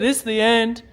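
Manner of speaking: loud, hammed-up cry of doom; mock doom. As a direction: play a panicking pet, overdramatically. Voices scared pet's dramatic doom.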